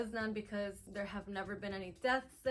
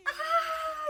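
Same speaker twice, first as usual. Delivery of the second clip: high short laughing shout; gleeful scream; 7 dB louder than usual. Retold or imitated. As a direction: whoop like on a fun drop. Describing the roller coaster drop.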